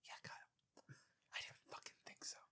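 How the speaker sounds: hushed whisper, almost no voice. Pretend. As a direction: breathe it as a secret aside. Mock-conspiratorial aside to Kyle.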